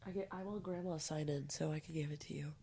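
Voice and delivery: whispered aside. Real, not acted. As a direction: say it under your breath. Whispered aside while recording.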